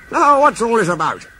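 a kindly boss wanting the news; Fat Controller's voice. Acted. The Fat Controller asks the engines what's wrong, genially.

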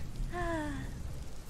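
long soft relieved sigh. A narrator's reading. Aldara sighs with relief that Arthur is okay. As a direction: let the worry out in a breath. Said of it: "she sighed, relieved".

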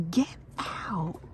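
soft stunned whisper with a catch; whispered disbelief. Real, not acted. Stunned by unseen items, whispering in disbelief. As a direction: breathe it in amazement.

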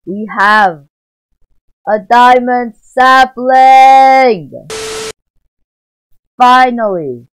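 from loud to a very high, very loud, slow triumphant shriek; building to ecstatic scream. Real, not acted. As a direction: build up, then scream the win. Diamond sapling finally obtained.

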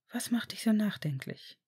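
soft whispered question. A narrator's reading. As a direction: whisper it close while dancing. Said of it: "flüsterte Draco".

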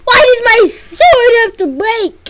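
high, loud wailing complaint; mock lament. Real, not acted. Gamer wails over the broken sword, high and loud. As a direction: cry out over the lost sword.

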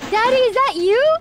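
high, loud hammed-up calling question; escalated mock-startled cry; mock freaking out. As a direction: call it out in exaggerated high-pitched shock. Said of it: "I was, like, freaking out".

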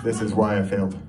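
ordinary delivery

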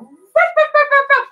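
high, playful yapping barks; dog barks. Shown as a demonstration. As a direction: bark like a puppy for the kids. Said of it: "like a dog".

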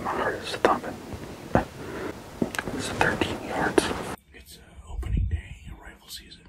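excited hushed whisper. Real, not acted. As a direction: whisper with heart pounding. Whispering in the blind.

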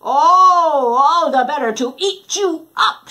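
loud, menacing wolf cry; wolf's roar. Acted. Wolf leaping at Red, loud roar. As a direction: roar it out to scare.

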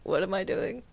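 ordinary talk, nothing marked

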